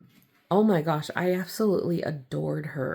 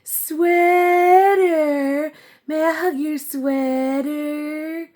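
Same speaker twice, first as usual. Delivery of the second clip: put-on childlike plea; 11 semitones higher, 7 dB louder, slower than usual. Childlike squeal asking to hug.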